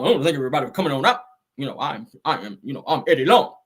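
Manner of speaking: low imitation, rushed thanks then halting; Eddie Long. Host mimics the preacher introducing himself, low rushed imitation. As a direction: mimic the preacher racing, then stumbling over his name. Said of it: "his voice was like this".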